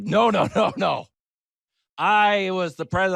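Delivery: high, protesting mock Van Buren; insist, offended, in a high voice; indignant Van Buren. Van Buren arguing with him in the afterlife: high indignant protest. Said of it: "He's like".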